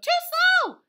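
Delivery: short, high, loud crow; rooster crow. Acted. Rooster judges the truck's progress, loud crowing. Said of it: "The rooster crowed".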